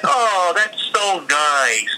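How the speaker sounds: slow, high, pleased drawl; naive delight. Retold or imitated. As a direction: play it sweetly clueless and slow. Retold naive pleased reply.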